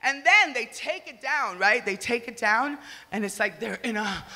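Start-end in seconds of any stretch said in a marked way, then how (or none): none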